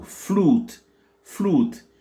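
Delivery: "flute" with a long oo, pitched high; high-pitched English model "flute". Shown as a demonstration. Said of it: "Donc, on dit ou".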